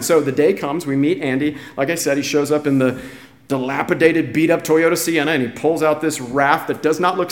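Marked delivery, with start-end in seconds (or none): none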